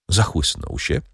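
plain reading voice

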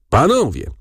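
high-pitched cry for attention; mild narrated yell. A narrator's reading. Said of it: "wrzasnął adwokat". (lekki okrzyk, wysokim głosem)

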